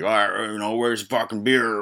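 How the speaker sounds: put-on slow, slurred drunken grumble; demanding drunk hobos. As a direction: garble it like drunks wanting booze. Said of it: "already demanding more booze".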